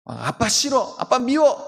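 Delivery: put-on pouting child, voice rising; sulking child. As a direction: act a petulant little kid. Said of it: "아이들이".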